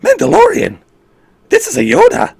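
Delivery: very high, loud squeaky Yoda. Pretend. Streamer doing Yoda for viewers, squeaky and very high. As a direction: squeak like a tiny wrinkled alien. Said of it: "We gotta do this in a Yoda".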